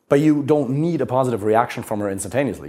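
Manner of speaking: normal talking voice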